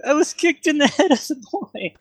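very high, daft guard voice; donkey-brained guard. Acted. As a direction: explain yourself as a squeaky simpleton. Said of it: "Donkey brained".

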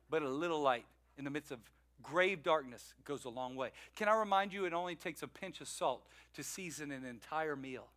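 quiet sermon point for the congregation; soft preaching. Real, not acted. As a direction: lean in and say it softly. Preacher drops to intimate point.

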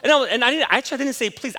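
usual speaking voice

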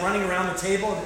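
ordinary delivery

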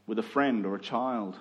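ordinary delivery

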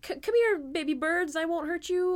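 high, cooing mock coaxing voice. Host imagines luring shy improv newcomers, coaxing. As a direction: lure timid little creatures closer.

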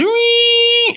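very high squeaky mock whine of the earbuds; imitated high whine. Angry AirPods owner imitating the earbud noise, high squeal. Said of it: "It goes".

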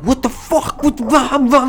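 high mock yelling, blah blah; hammed-up yelling from next door; mock screaming. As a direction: imitate the neighbors screaming through the window. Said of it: "just all this screaming and shit".

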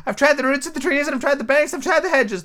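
very high, fretful bird voice; ranting pigeon. Acted. Pigeon ignoring Alice, high and aggrieved. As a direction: rant on in a high squawk. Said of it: "went on without attending to her".

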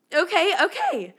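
high, loud okay okay. Real, not acted. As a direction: cut yourself off, loud and high. Shaking off the fan letter.

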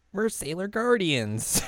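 high, slow proud declaration; proud Chibiusa. Retold or imitated. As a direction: announce it like a little hero. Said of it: "And Chibiusa is like".

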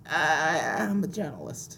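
very soft, strained reporter voice with vocal fry; barely-there voice, as if nearly out of breath; Eddie Brock. Acted. Co-host gives best attempt at Eddie Brock, hushed impression. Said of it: "my best attempt at an Eddie Brock impression".

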